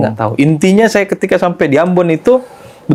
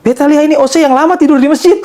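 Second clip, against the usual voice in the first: very high imitated Ambonese voice; high Ambonese lilt; Ambonese accent; 12 semitones higher, 3 dB louder than usual. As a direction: mimic an old man's Ambonese dialect, pitched right up. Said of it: "Pakai logat Ambon kan".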